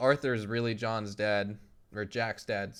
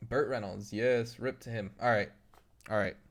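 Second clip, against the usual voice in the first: normal talking voice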